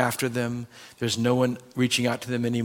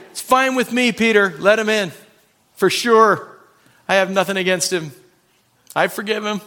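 higher and louder, overly gracious; mock magnanimous; 7 semitones higher, 5 dB louder than usual. Pastor answering Peter, exaggeratedly gracious. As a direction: play the saint, a bit too sweet.

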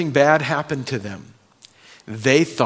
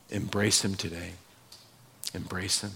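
softer and softer, lower each time; fading plea; 6 semitones lower, 11 dB quieter than usual. Real, not acted. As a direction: let the appeal fade to almost nothing. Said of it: "closing appeal fading".

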